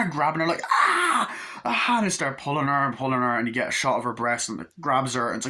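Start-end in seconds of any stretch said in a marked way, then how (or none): 0.7-2.0 very high imitated scream; mock shriek. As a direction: shriek like a grabbed horror victim. Imitates film victim being grabbed.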